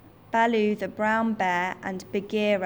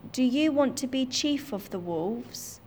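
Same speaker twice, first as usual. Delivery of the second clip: wolves asking; 4 semitones higher than usual. Acted. Wolves offer Mowgli leadership.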